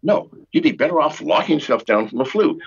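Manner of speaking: ordinary delivery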